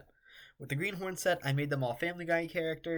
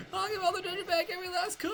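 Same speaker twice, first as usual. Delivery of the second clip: high character voice; faster than usual. Acted. Character voice repeats plea.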